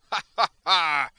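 huge, high, slow villain's cackle; exultant evil laugh. Acted. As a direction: roar with gloating triumph. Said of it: "the Butcher exulted".

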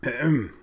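high, pointed ahem; throat clearing. A narrator's reading. Derek trying to rouse an unmoving figure, attention-getting ahem. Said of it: "Derek cleared his throat".

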